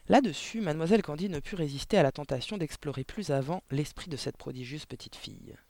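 usual speaking voice

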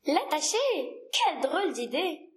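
taken aback, incredulous question then exclamation; shocked. Acted. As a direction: react as if the proposal is absurd. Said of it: "parut choquer le petit prince". (choqué et incrédule)

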